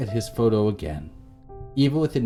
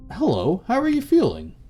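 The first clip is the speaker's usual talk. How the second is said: very high, louder hello; high-pitched greeting; 13 semitones higher, 5 dB louder than usual. A narrator's reading. Caller asking how someone is feeling, high-pitched greeting.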